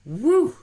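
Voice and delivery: high, loud "woo"; excited whoop. Real, not acted. Reacting to the fight recap.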